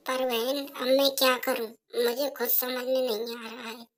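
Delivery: put-on soft, timid young wife offering tea; softly offering tea to her mother-in-law; meek daughter-in-law. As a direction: hand over the tea quietly and politely.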